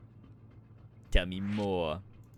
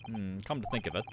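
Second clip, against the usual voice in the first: ordinary delivery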